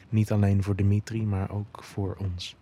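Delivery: usual speaking voice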